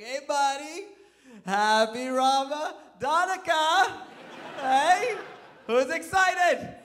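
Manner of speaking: high, loud, slow cheery dad voice; hammed-up gushing dad; overexcited dad. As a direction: pump up the little boy, hammed-up. Dad cheering on his son.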